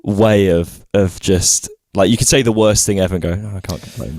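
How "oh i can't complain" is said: muttered Brit's "Oh, I can't complain", much softer and lower; British understatement. Retold or imitated. Quoting the British stock phrase, a soft dry mutter. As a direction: throw it away like a stoic Brit. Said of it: "the most British".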